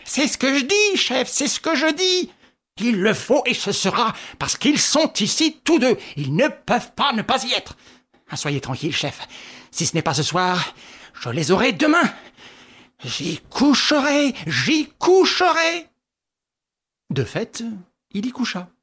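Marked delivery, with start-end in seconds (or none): 0.1-7.8 stubborn insistence. Acted. 13.0-15.9 stubborn vow. Acted. Repeated vow.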